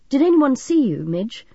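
mildly anxious concern. A narrator's reading. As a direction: press for reassurance. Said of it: "Chris asked anxiously".

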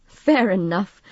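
plain reading voice

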